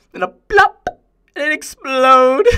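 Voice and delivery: high, loud burst of sadistic glee; delighted, cackling outburst; manic glee. Acted. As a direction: blurt it out gleefully, breaking into laughter. Gleefully recounts an exploding ant.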